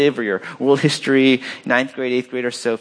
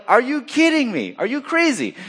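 much higher, hammed-up disbelief; incredulous; 13 semitones higher than usual. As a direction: challenge him as if he were mad. Sailors' incredulous reaction, acted out.

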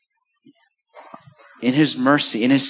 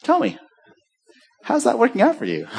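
gentle teasing question; 7 semitones higher than usual. Retold or imitated. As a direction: ask it lightly, half amused. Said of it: "what I'll just typically say is".

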